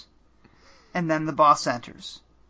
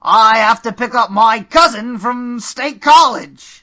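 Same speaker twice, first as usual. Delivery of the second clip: loud, high, slow bad Australian accent; awful Australian accent; 7 semitones higher, 10 dB louder, slower than usual. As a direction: ham up a terrible Australian accent. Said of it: "an awful attempt at an Australian accent".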